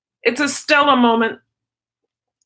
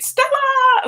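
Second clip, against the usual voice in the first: calling the dog. Real, not acted.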